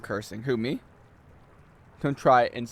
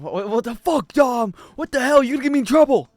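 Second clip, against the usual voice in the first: alarmed yelling; 10 semitones higher, 7 dB louder than usual. Real, not acted. Caught cursing, yelling at friend.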